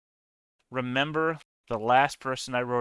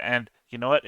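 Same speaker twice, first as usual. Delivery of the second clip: normal talking voice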